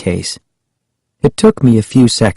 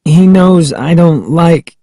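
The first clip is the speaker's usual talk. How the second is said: higher-pitched protest; lightly voiced girl, pitched up in protest; 7 semitones higher than usual. A narrator's reading. Child protests in dialogue, higher voice.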